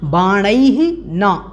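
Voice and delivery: very slow "बाणैः", then "न" clearly apart; split the words. Shown as a demonstration. Teacher shows students the two separate words, slowly. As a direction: drag out "बाणैः" and set "न" apart. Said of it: "ये दोनों अलग अलग हो जाएंगे".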